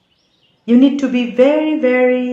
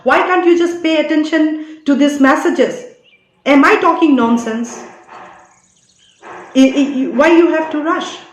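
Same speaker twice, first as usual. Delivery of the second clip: raised, heated scolding of the viewers; getting angry; 5 semitones higher, 3 dB louder than usual. Real, not acted. Scolding viewers for rushing, real anger. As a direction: really lose patience with the audience, voice up and hard. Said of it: "like the way I am getting angry".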